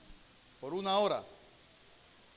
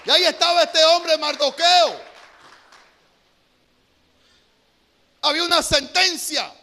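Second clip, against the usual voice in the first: louder, higher preaching voice building to a climax; fiery preaching; 6 semitones higher, 10 dB louder than usual. Acted. Preacher urges the congregation to choose God, fiery preaching. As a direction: drive the call to decide home.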